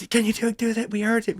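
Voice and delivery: put-on high, eager kid voice; excited kids. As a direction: beg like an excited child. Kids begging, voiced higher.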